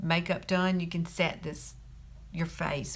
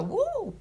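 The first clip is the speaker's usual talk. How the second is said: high-pitched whoop of delight; delighted woo. Shown as a demonstration. Beauty vlogger showing what rose water does, high whoop. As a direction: let out a high, playful "woo". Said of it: "It makes you go".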